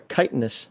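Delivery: chitinous said softly as KITE-in-us; long i in chitinous; dictionary pronunciation. Shown as a demonstration. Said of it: "it says right there".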